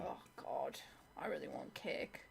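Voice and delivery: soft whispery longing; whispered craving. Real, not acted. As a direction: breathe it out with longing.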